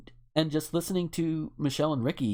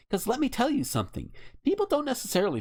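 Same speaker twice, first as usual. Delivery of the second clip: ranting; 11 semitones higher, faster than usual. Real, not acted. Ranting at gun owners.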